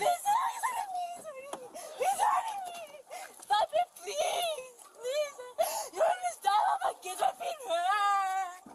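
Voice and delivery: high screaming and crying; frantic. Real, not acted. Suspect protesting as officers restrain her, frantic. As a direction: wail hysterically as if being hurt. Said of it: "she went full-blown crazy".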